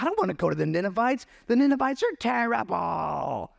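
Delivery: high, rising whine, ending in a shout; mock Jonah refusing God; whiny reluctant Jonah. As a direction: complain like a petulant prophet. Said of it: "He's like".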